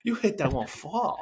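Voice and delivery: high, amazed Japanese-accented imitation; mock Japanese accent. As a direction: marvel like a Japanese executive at a big shot. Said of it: "Japanese executives".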